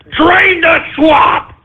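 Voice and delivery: slow, high shouted slogan; rallying shout. Real, not acted. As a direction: belt the slogan out slowly.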